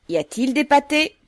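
loud, high call-out; resounding voice. A narrator's reading. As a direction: ring it out across the yard. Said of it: "d'une voix retentissante".

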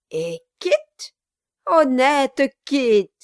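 loud, high, mocking dwarf voice; gloating dwarf. Quilp taunts Brass and his prisoner, loud mocking. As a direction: jeer at them like a grinning goblin.

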